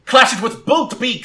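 high, loud trainer's call; shouted battle command. Acted. Trainer orders Sparkle to counter, shouting. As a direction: call the move across the field.

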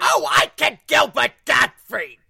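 loud slow squawking Gilbert Gottfried. Retold or imitated. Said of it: "you're gonna be Gilbert Gottfried".

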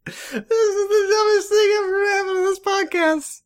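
high, crying with laughter; laughing. Real, not acted. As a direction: squeak it out through laughter. Laughing at the bit.

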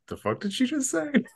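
high-pitched, baffled disbelief; acted-out stunned question; incredulous mock reaction. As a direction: squeak it out like you can't believe your ears. Acting out a stunned bystander's reaction.

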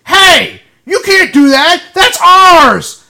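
loud, high yell of protest; retold yell. Fletch yelling at a girl taking the foam finger, loud high yell. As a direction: bark it out to stop her grabbing the prop. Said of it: "you just fucking yelled at".

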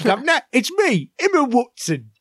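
high, posh-style British impression of Emma Watson; hammed-up British accent; mock British accent. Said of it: "Your British accent".

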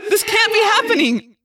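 very high, loud wail of disbelief; anguished cry. A narrator's reading. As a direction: cry it out at the peak of despair. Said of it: "I cried".

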